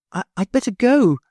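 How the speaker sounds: put-on a little girl hurrying off; high child's voice; little girl Sylvie.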